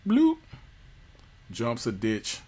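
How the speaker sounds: ordinary talk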